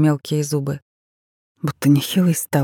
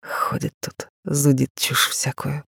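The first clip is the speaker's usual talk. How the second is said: dismissive; 4 dB quieter, slower than usual. A narrator's reading. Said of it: "отмахнулся Тарас".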